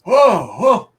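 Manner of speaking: loud, high startled cry; startled gasp. Acted. Archer sitting up suddenly, startled high cry. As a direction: jolt awake with two breathy bursts.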